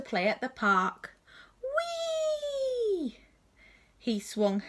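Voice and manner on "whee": high, long swooping whee; gleeful whee. Acted. Robot Bernard squealing high on the swing.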